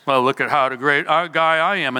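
mock boasting, tossed off quickly; putting on airs. Preacher imitating someone puffed up to followers, mock boastful. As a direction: play a self-important show-off, lightly hammed up. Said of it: "if someone puts on airs".

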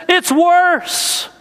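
high, weepy "It's worse"; mock tearful. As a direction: sob out the bad news. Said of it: "through her tears".

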